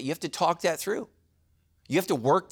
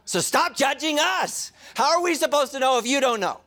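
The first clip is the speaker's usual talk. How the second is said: playful protest; 9 semitones higher, 4 dB louder than usual. Real, not acted. Playful complaint to wives.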